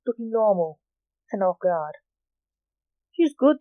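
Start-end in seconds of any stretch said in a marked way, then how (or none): 3.1-3.6 light, higher tenor. A narrator's reading. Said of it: "in a light tenor voice".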